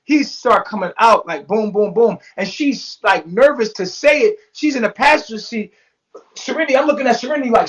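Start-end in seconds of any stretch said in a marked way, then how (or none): none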